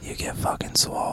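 hushed, voiceless whisper; mock-dramatic whisper. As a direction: whisper it like a big secret. Hushed hype about Swole City.

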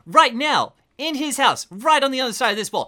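high, urgent threat; panicked apartment owner. Acted. Apartment owner threatening intruders with his neighbor, high and frantic.

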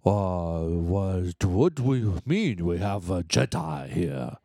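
low, slow alien impression; mock Federalist voice. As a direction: put on a slow, low caricature. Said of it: "Federalists were like".

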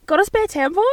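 high, loud mock-urgent question; gasping, hammed-up plea; acted request. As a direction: ask it high and loud, as a playful catchphrase. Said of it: "just say".

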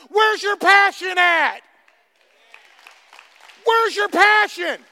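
loud and slow; slow preaching shout; slow shouted challenge. Acted. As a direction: drag each word out to the crowd.

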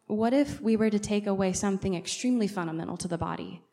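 ordinary talk

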